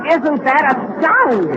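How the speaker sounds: slow, high mock amazement; sarcastic astonishment. Boss mocking the announcer's silly discovery, slow and sarcastic. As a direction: drag it out, pretending to be impressed.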